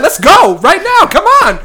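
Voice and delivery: high, loud call-out; mock fight challenge. Student squaring up on the staircase, loud mock challenge. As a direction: dare them to brawl at full volume. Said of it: "and be like".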